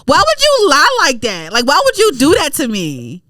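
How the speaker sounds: high, incredulous rant; mad. Retold or imitated. Tenant confronting bank over negative balance, mad high rant. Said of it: "I was mad at them".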